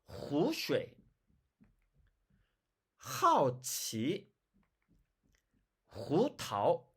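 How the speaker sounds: 후쉐, 하오치, 후타오 slow, each h rough in the throat; husky Chinese h. Shown as a demonstration. Teacher giving new H examples, husky h. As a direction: space out 하오치 with a rasped first sound. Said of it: "중국말에서는 허스키하게 발음을 했습니다".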